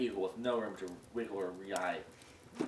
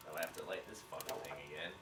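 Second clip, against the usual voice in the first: ordinary delivery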